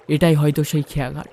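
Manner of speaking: ordinary delivery, nothing marked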